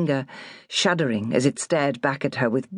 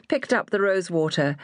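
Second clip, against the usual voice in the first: usual speaking voice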